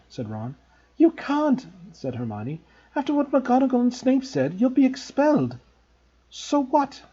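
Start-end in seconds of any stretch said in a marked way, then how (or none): 1.0-5.6 high, anxious protest; worried girl protesting; alarmed. A narrator's reading. Hermione alarmed. 6.3-7.0 high, defiant shout; boy shouting defiance at friends. A narrator's reading. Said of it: "Harry shouted".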